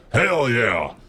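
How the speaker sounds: loud, high hell yeah; excited. Real, not acted. Streamer confirming news to chat, excited shout. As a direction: punch it out with glee.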